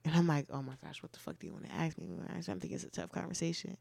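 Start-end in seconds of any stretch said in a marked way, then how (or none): 0.5-2.1 low, very soft aside; private dread. Retold or imitated. Imagining a partner's warning of a talk, muttered dread. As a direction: mutter a worried thought under your breath, quickly. Acting out anxious inner reaction.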